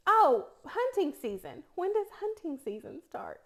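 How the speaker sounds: put-on careful speech, East Tennessee accent suppressed; without an accent. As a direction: enunciate each word to hide the East Tennessee accent. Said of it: "do this without an accent".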